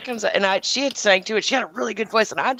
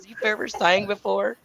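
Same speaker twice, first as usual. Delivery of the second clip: rising, sarcastic "You've ever sang before?" that parrots the dude; mocking echo; 4 semitones higher, 4 dB quieter than usual. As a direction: throw his own line back at him with scorn. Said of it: "That's the best you got?".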